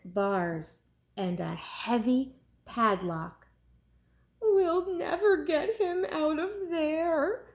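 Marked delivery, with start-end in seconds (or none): 4.4-7.5 higher crying moan; tearful moan. Acted. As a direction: moan it through tears. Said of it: "moaned their mother".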